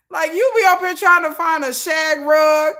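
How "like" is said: ordinary delivery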